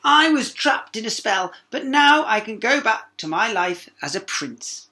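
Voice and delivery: high, loud, slow prince. A narrator's reading. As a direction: announce joyfully as the prince. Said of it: "he cried".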